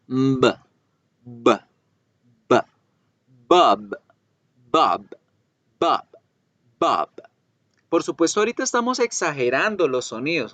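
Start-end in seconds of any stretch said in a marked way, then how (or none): 0.1-7.1 b and bob with the lips vibrating; buzzing b. Shown as a demonstration. Teacher demonstrates the vibrating b in bob. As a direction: let the lips buzz on bob. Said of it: "deben generar una vibración en los labios".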